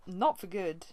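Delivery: plain reading voice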